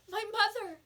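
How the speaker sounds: put-on a boy frantically pleading for his mother; frantic. A narrator's reading. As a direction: cry it out in desperation. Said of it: "reminded the storekeeper frantically".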